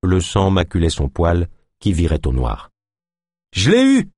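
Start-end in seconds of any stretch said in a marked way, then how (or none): 3.5-4.1 high, loud burst of triumph; triumphant exclamation. A narrator's reading. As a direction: exclaim it, thrilled at the hit. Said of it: "s'exclama Naya". (exclamation triomphante, aiguë et forte)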